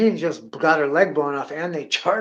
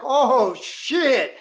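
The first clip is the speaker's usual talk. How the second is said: higher, louder mock shock; startled realization; 9 semitones higher, 4 dB louder than usual. Shooter realizing what popped, startled exclamation. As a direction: blurt it as the truth hits you. Reacting to the balloon popping.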